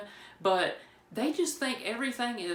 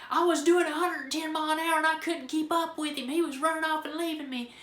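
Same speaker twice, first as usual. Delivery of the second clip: high, loud mock rant; imitating an irate officer, pitched way up; mad cop; 8 semitones higher, 3 dB louder than usual. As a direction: blurt it out in one worked-up run. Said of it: "he's already mad".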